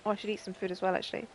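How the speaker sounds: ordinary talk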